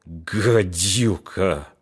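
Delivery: very slow, soft, rasping hiss of hate; hoarse wheeze. A narrator's reading. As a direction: rasp the word out in disgust. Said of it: "прохрипел".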